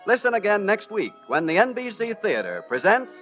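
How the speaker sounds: ordinary talk, nothing marked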